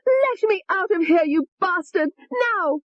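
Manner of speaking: high-pitched furious shriek at the doctor; a trapped woman screaming to be let out. Acted. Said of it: "she screamed".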